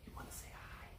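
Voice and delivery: soft whisper. Real, not acted. Talking to sleepy child.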